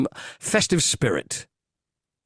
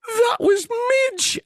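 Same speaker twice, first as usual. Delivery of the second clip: high, louder horrified wail; horrified cry; 4 dB louder, slower than usual. Retold or imitated. Retelling the film's ending, the woman's shocked high cry. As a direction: cry out the otter's name in shock. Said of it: "And she's going".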